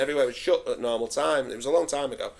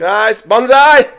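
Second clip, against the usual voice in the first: loud, high, drawn-out drinking cry; rallying toast; 7 semitones higher, 13 dB louder, much slower than usual. Real, not acted. Host toasting friends before a drink, shouted rally. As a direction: shout it long as the shots go up.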